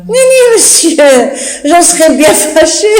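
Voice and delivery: high-pitched words through a giggle; giggling denial. Acted. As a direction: try not to laugh and fail. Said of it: "[name] n'arrête pas de rire".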